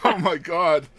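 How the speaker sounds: giggling before the joke; giggly lead-in to a dirty joke; laughing. Real, not acted. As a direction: laugh through the words.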